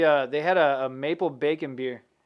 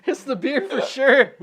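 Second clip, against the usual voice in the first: laughing; 8 semitones higher, 3 dB louder than usual. Real, not acted. Laughing about the beer.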